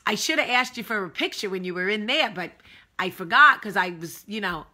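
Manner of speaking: put-on loud, emphatic Northern accent. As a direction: imitate a Northern lady angling for a favor. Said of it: "I like to do a northern accent".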